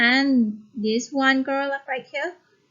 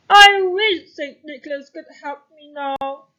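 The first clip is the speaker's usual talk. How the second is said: louder, breaking plea; despairing baker; 3 semitones higher, 9 dB louder than usual. Shown as a demonstration. Lonely baker pleading for Saint Nicholas's help. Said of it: "you must change your voice according to the character".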